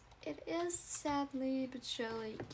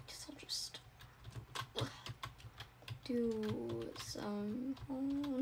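whispering; 2 semitones lower, 9 dB quieter, much slower than usual. Acted. Whispered repeat of line.

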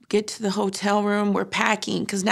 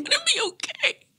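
crying question; faster than usual. Retold or imitated. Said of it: "lost it".

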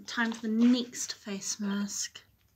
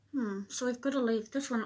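muffled behind hand; speaking through sheet mask; hand over mouth; faster than usual. Real, not acted. Seen 0: Hand covers mouth over sheet mask around 3.9-5.4, again at 7.4.

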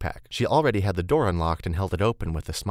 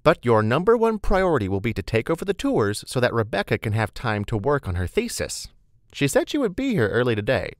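high, louder voice of a bossy colleague; brisk, chatty Beth; 5 semitones higher, 4 dB louder than usual. Acted. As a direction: hand out duties cheerily, pitched up.

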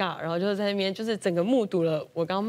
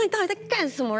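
higher-pitched retold scold at the kid; mock angry scolding; 9 semitones higher, faster than usual. Mom scolding the child over soaked pillows, mildly angry. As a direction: replay the angry mom question, lightly. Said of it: "然后我就狂骂他".

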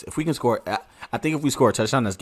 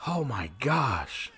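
stunned outcry; 6 dB quieter, much slower than usual. Real, not acted. Big play on the field.

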